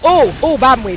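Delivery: usual speaking voice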